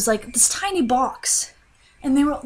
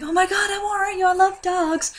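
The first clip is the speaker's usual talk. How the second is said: put-on much higher, sweet baby talk; cooing reassurance; 10 semitones higher than usual. Dreamer coos to the scared beagle, high and sweet. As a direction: coo to a frightened puppy. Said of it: "And I'm like".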